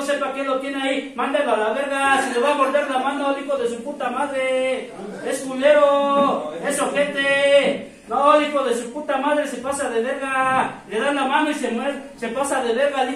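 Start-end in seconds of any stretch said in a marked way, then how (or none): 5.3-7.9 drawn out, each word spaced and weighted; slow emphatic insult. Acted. Sponsor condemning the newcomer, slow and deliberate. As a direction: lay it down slowly like a verdict.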